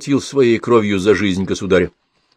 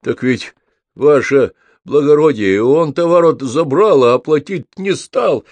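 slow, high plaintive wail; aggrieved peasant lament, drawn out; nearly sobbing; 5 semitones higher, 4 dB louder, slower than usual. A narrator's reading. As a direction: protest as if close to tears. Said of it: "чуть не рыдая".

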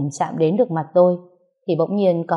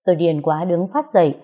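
usual speaking voice

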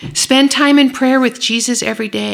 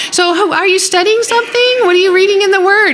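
high, fast, gushing voice; fast, high, over-the-top friendly; mock overeager; 10 semitones higher, 5 dB louder, faster than usual. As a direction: ham up a too-eager stranger. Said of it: "like a normal person".

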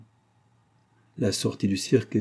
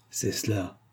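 softly said to oneself; quiet, grim satisfaction; funereal joy; 6 dB quieter, slower than usual. A narrator's reading. Antonine to herself as fever takes her, funereal joy. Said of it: "avec une joie funèbre".

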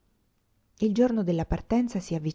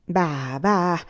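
hurt, mortified exclamation. Acted. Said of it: "esclamò zia Tatana, mortificata e addolorata".

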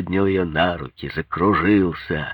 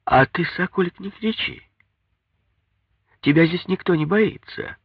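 high-pitched, challenging mother-in-law; defiant; 12 semitones higher than usual. A narrator's reading. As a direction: defy him in a high character voice. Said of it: "ответила она с вызовом".

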